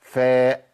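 فاء hit hard, lip pressed firmly; firmly placed fa. Shown as a demonstration. Teacher demos a firm f to students. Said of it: "لازم أقولها كده".

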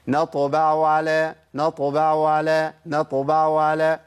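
العين في "نطبع على" باهتة لا تظهر; "نطبع" بعين ساقطة; faded ain. Shown as a demonstration. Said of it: "العين بهتت خالص". (العين بهتت خالص)

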